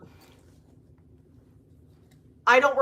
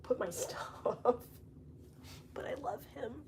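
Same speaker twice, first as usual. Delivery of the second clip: whispered, much softer, with a laugh; hushed aside; 15 dB quieter than usual. Real, not acted. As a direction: trail into a soft whisper with a small laugh. Fond laughing aside about bunny.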